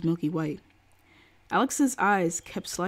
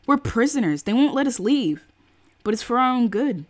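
loud, higher-pitched argument; raised-voice quarrel; heated protest; 5 semitones higher, 7 dB louder than usual. Acted. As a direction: push it out loud, indignant. Acted quarrel over being kept in.

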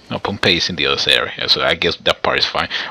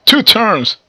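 loud, high burst of excitement; real high-pitched excited cry; excited shout; 9 dB louder than usual. Real, not acted. Gamer reacts to the countdown.

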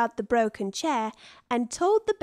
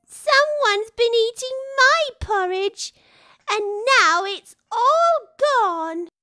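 much higher, plaintive cub voice; Baby Bear voice; 11 semitones higher, 5 dB louder than usual. A narrator's reading. Baby Bear over his empty bowl, high and upset. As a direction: wail it like a small upset cub.

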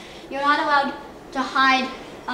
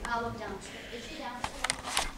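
whispering while hiding; 13 dB quieter than usual. Real, not acted. Searching quietly for hidden sister.

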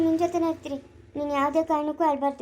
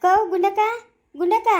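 loud, raised-pitch hail; calling out; 4 semitones higher, 6 dB louder than usual. Acted. Hailing an elder sister by name, calling out. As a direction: call her name across the yard, rising.

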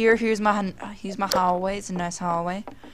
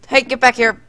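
loud sharp call, pitch up; shouting; 6 semitones higher, 8 dB louder, faster than usual. Pretend. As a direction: yell it after someone walking off. Calling back a wandering guest.

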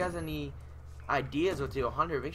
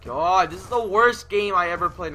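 exasperated complaint; 4 semitones higher, 9 dB louder than usual. Real, not acted. Complaining about the game.

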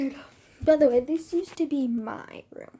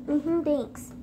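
usual speaking voice

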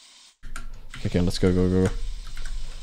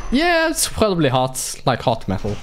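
usual speaking voice

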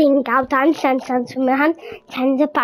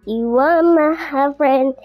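normal talking voice

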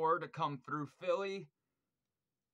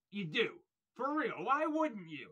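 higher and louder, pushing the point home; excited insistence; 6 semitones higher, 5 dB louder than usual. Real, not acted. Fan insisting Worm must tour with Mortiferum, loud and excited. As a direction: insist like it's obvious to anyone.

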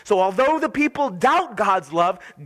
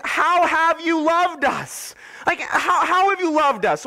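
doubting people; 6 semitones higher than usual. Acted. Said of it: "and they're saying".

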